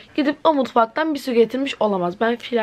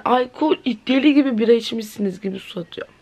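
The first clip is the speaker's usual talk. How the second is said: ordinary talk, nothing marked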